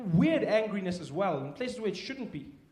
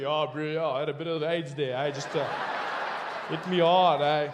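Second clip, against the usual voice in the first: casual bro; 4 semitones lower, 5 dB louder than usual. Acted. [name] answers in acted scene.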